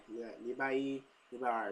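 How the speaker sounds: ordinary talk, nothing marked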